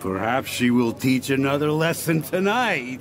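laughing through a bawdy jibe; a mocking laugh at the end; sniggering joke. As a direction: snicker it out, leering.

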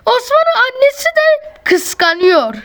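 high squeal; excited. Real, not acted. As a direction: tease the jealous mother. Gossiping about jealous animal.

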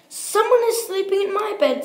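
high, quick little cub; Baby Bear's wee little voice. Acted. Baby Bear finds someone in his bed, wee little voice. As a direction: pipe it up fast like a small bear. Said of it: "in his wee little voice".

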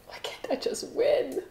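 breathy whispered complaint. Real, not acted. Griping about the lottery to viewers, whispered. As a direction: hush it like a secret gripe.

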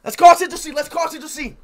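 high, loud and eager; hyped. Real, not acted. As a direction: pump up the chat. Hyped announcement to chat.

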